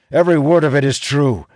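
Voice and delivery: loud, high defiant shout; shouting back. Acted. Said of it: "Elias shouts back".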